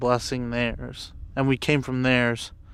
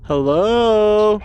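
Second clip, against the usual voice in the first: loud, high call into the dark; 8 semitones higher, 11 dB louder than usual. Real, not acted. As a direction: call out loudly to someone unseen. Calling out into the dark.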